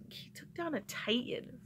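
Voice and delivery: awed whisper. Real, not acted. Vlogger marvels at Percy to viewers, whispered. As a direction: breathe it out in a whisper, no voice.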